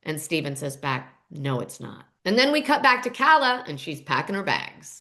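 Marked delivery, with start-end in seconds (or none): 1.3-2.0 low, soft retold reply; subdued agreement. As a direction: agree quietly, voice dropped. Retelling son's subdued agreement.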